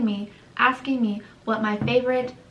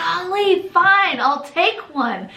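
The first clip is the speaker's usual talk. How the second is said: higher, louder, fed-up surrender; exasperated giving in; 8 semitones higher, 5 dB louder, slower than usual. Retold or imitated. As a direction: cave in with a huff. She gives in, exasperated.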